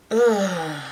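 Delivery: loud, falling groan of dread; uneasy "ugh" at a scary memory; shuddering ugh. Real, not acted. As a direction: shudder it out, creeped out.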